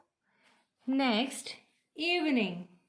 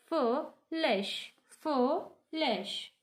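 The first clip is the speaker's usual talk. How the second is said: FOOLISH broken in two, foo-lish, with a pause between; split into syllables; 2 semitones higher, much slower than usual. Shown as a demonstration. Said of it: "We have two syllables".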